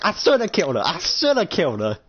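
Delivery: high, heated outburst; regretful rage. Acted. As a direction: curse yourself in acted fury. Acted rage after phone call.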